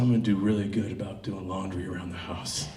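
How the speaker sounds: low, soft mock pillow talk; seductive murmur. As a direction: murmur it low into a lover's ear. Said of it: "I go up to her ear".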